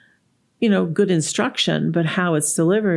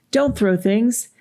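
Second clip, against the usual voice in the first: high-pitched parental scolding; acted sharp order to a child; cross parent laying down the rule; yelling parent; 6 semitones higher, slower than usual. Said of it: "the parent is yelling at the child".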